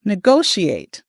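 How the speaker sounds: "Negotiate" with the second syllable, go, stressed; slow practice "Negotiate", weight on GO. Shown as a demonstration. Coach practising this together with students, stress on GO. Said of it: "second syllable".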